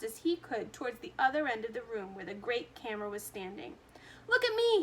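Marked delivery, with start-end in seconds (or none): 4.3-4.8 put-on high, loud boyish shout. A narrator's reading. Boy running to the camera, high loud shout. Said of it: "he shouted as he ran".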